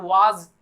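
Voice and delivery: was as waaz with long aa; wrong pronunciation. Shown as a demonstration. Teacher rejecting a mistake, wrong pronunciation of was. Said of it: "it is not was".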